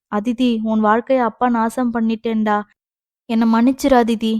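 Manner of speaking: usual speaking voice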